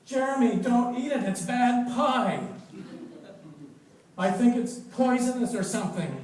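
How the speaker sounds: high, loud, desperate plea; frantic warning shout. Acted. Boy shouts to warn his friend, frantic and loud. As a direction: yell at him to stop.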